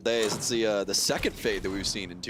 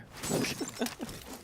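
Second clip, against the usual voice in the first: airy laugh; 13 semitones higher, 8 dB quieter than usual. Real, not acted. Laughing after apology.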